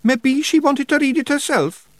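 high Scottish wee man, unsure; a hesitant little pixie voice; doubtful Feegle. A narrator's reading. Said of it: "said Billy doubtfully".

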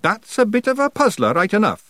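high Scots character voice; puzzled Feegle. A narrator's reading. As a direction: concede the point in high Scots. Rob's character voice, conceding.

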